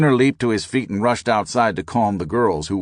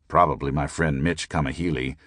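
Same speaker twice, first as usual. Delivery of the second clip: ordinary delivery, nothing marked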